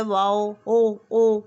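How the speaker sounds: long, loud drawn-out "ओ" sound for वाव; drawn-out vowel sound. Shown as a demonstration. Teacher showing KG class the ओ sound. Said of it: "वाव की आवाज़ तो आपको पता है ना कौन सी होती है".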